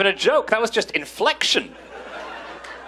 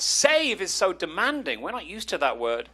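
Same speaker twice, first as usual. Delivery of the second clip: usual speaking voice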